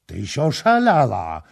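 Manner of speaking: loud, high burst of disbelief; shocked exclamation. A narrator's reading. Prince exclaiming at Kama's wild claim, loud and shocked. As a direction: fling it at her in alarm. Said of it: "zawołał książę".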